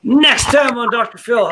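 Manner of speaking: announcer voice for a daytime talk show teaser; TV promo voice. Pretend. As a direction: sell it like a TV trailer.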